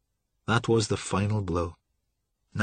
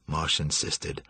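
plain reading voice

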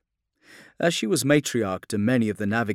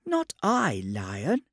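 cringing dread; 5 semitones higher, much slower than usual. A narrator's reading. Servitor denying blame to the Lion, in dread. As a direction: pitch leaping high then dropping. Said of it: "The puppet cringed in genuine dread".

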